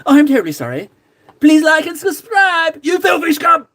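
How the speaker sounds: hammed-up shouted insult. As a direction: bellow a cheeky rude sign-off. Mock rude sign-off to viewers.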